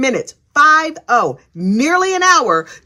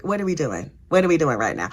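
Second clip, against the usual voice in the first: low, soft disbelief; quiet, dropped-down exasperation; exasperated mutter; 7 semitones lower, 6 dB quieter than usual. Retold or imitated. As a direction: mutter under your breath in disbelief. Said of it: "And I was like".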